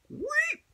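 quick, very high whistle-like "wheep"; a tiny high zip of escape; high squeaked whoosh. Retold or imitated. Storyteller mimics slipping out the door, high squeaked sound effect.

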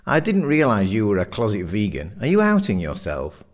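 ordinary delivery, nothing marked

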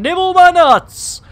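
silly shout. Pretend. Catchphrase shouted.

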